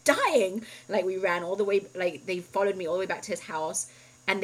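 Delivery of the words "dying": high, loud frantic shout; panicked yell. Retold or imitated. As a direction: scream for them to hurry. Retold panicked yell for ambulance.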